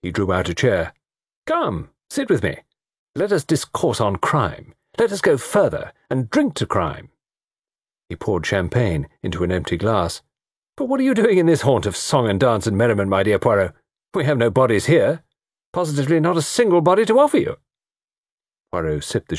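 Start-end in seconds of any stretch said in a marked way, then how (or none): 1.4-7.1 put-on high, theatrical young toff; grandly inviting, playful and pitched up; jovial young man. 10.8-17.6 put-on high, teasing young man; theatrical banter with a detective; jovial young man.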